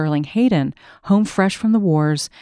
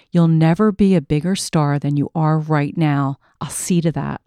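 ordinary delivery

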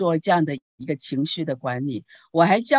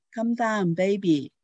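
gentle soothing; 4 semitones higher, slower than usual. Retold or imitated. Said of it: "声音很温柔的哈".

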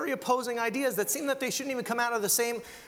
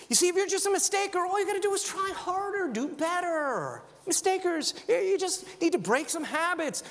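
breezy mock voice; 9 semitones higher than usual. Mocking breezy mistaker.

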